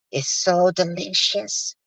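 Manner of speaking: lightly voiced villain, slow and soft; cartoon villain. Mocking her as a gloating villain, hint of slow cartoon evil. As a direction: savor it slowly like a Disney villain. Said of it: "like the evil villain in a Disney cartoon".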